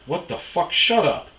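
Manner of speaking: high, loud hissed outburst; mock yell to shut him up; retold shocked shout. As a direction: friend asking the host for a shower, shocked loud telling-off. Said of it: "And I'm like".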